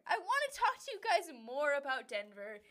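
talking through real laughter; laughing while talking. Real, not acted. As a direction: giggle the words out, amused. Laughing through repeated line.

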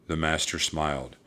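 plain reading voice

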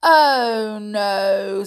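loud, gloating refusal; mildly wicked giant; wicked grin. A narrator's reading. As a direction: draw it out, relishing the refusal. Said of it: "with a wicked grin".

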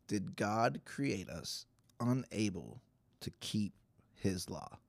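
ordinary delivery

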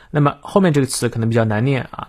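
ordinary talk

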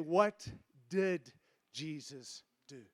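What did Jesus do? with did stretched long and falling, the rest soft and slow; 1 stress on did. Shown as a demonstration. Preacher gives the church a new slogan, stress on did. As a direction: lean hard on did and let the line sink. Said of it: "don't say W W J D anymore. Put in there".

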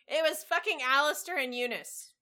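normal talking voice

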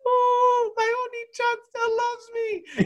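hammed-up little sister inner monologue; little girl voice. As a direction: squeak it out like a tiny girl. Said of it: "like, this little girl".

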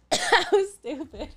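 breaking up laughing at herself. Real, not acted. Laughs at her childhood self.

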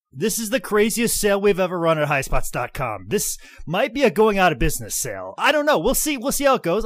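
loud, high excited sales pitch; hyped ad read. Acted. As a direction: sell it hard like an infomercial.

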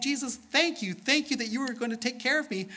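high, thankful little flower voice; praising flower. Acted. Dirt-covered flower thanking Jesus for care, high praising voice. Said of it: "praising God and saying".

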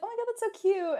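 high-pitched squeal of delight; gushing delight. Retold or imitated. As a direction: squeal over the cute gift idea. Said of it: "and I was like".

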